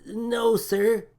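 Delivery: a timid crewman sputtering. A narrator's reading. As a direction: stammer it out, caught off guard. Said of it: "sputtered the man".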